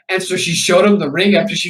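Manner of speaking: ordinary delivery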